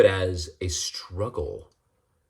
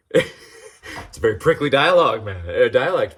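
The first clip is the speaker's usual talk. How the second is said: laughing; 4 semitones higher, 6 dB louder than usual. Real, not acted. Laughing at Aussie accent.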